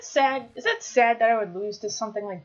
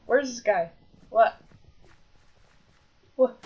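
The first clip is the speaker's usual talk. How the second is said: normal talking voice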